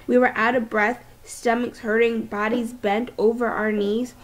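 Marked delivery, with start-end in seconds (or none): none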